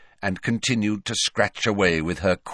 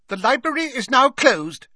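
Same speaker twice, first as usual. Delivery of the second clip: very high, loud stern librarian; Madame Pince; 5 dB louder than usual. A narrator's reading. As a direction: announce it as a high-pitched, forbidding librarian. Stern librarian interrupts them.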